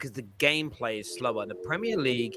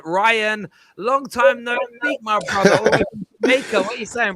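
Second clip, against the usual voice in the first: excited greeting; 7 semitones higher, 6 dB louder than usual. Real, not acted. Host greeting caller.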